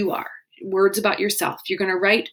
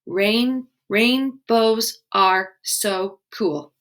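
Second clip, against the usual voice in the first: rainbows are so cool said very slowly, syllable by syllable; slow syllable count; much slower than usual. Shown as a demonstration. Teacher composing a haiku line with kids, slowly. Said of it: "So you could say".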